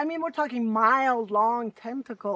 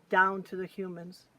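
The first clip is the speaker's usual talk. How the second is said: plain reading voice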